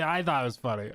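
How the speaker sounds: usual speaking voice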